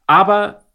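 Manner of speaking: Aber punched high and loud; stress on Aber. Real, not acted. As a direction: hit the word Aber hard, then pause. Emphatic turn to warning.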